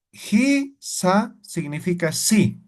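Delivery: Hisa spoken slowly and clearly, falling at the end; slow explanation. Shown as a demonstration. Teacher slowly explaining Hisa to students. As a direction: spell it out slowly for learners. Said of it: "teacher explains word slowly".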